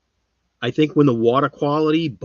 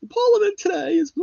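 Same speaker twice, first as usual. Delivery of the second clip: very high mock Queen's English; Queen's English accent. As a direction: ham up the Queen's English in falsetto. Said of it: "Or is the Queen's English?".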